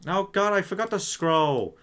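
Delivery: high, slow baffled cry; high drawn-out bafflement; startled. Real, not acted. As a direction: squeal out the disbelief slowly. Guessing cries, startled.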